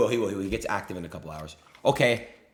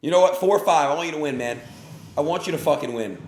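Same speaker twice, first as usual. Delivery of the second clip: loud, higher mock encouragement; sarcastic shout; 6 semitones higher, 6 dB louder than usual. Real, not acted. Streamer sarcastically shouts at the player he blames. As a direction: yell it, dripping sarcasm.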